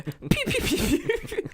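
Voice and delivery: high laughing gun sounds; laughing pew pew. Pretend. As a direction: fire off playful pews.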